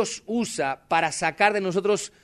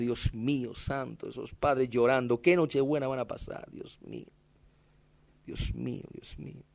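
much softer, fading to a whisper; tearful whispered prayer; 6 semitones lower, 9 dB quieter than usual. Retold or imitated. Pastor walking home on Christmas Eve, whispering tearful prayer. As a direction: pray brokenly under your breath. Praying while crying alone.

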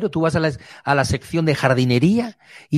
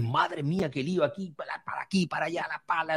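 dismayed mutter; 2 semitones higher, 13 dB quieter than usual. Retold or imitated. Seeing the ploughed land, muttering softly in dismay. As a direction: grumble it softly, overwhelmed by the chaos.

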